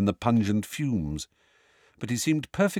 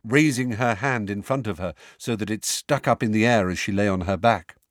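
ordinary delivery

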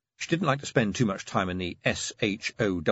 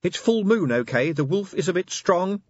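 irritated; 10 semitones higher, 5 dB louder than usual. A narrator's reading. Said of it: "snapped Angua".

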